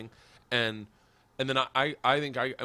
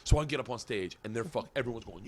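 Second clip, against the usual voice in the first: ordinary delivery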